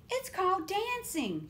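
high, bright little frog; Betsy's voice. A narrator's reading. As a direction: lift the voice for Betsy. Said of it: "she said".

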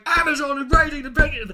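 high, loud, fast mock announcement; mock excited shout. Mimicking a ranter's take on the news, shouted high and fast. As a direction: yell it like a ranting drunk. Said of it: "with Bob going".

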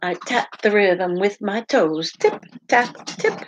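lively, sing-song picture book read-aloud; animated storytime voice with playful sound words; reading to children. Acted. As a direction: read it out to little listeners with bounce.

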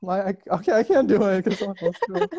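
ordinary talk

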